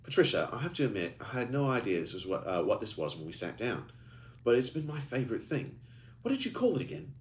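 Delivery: a lightly voiced Birmingham accent; older man with a fading Birmingham accent. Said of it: "asked Gerald in his fading Birmingham accent".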